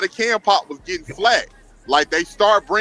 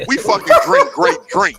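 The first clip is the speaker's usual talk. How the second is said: high, insistent stress on "grape drink"; "grape drink" pitched up emphatically; emphatic correction; 4 dB louder than usual. Real, not acted. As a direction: set them straight, punching "grape drink".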